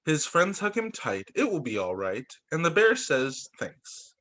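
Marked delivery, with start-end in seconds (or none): 3.6-4.1 breathy whispered thanks. Acted. Bear thanking his friends, whispered. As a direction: barely breathe the word.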